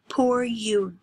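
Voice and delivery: sneering, hushed; whispered mock pity. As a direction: whisper it with scorn. Andrews mocks Lena.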